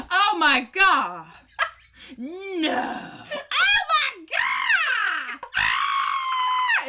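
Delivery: high shrieking squeals, rising to a scream; imitated squealing. Sister mimicking roommate's squeals through the door, shrieking high. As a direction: squeal like a reader losing it over a romance. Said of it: "I would just hear, like".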